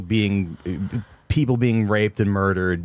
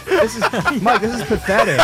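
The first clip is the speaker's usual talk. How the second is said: scolding host impression; 9 semitones higher than usual. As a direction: play a disappointed host. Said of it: "Imagine Lewis has to ... be like".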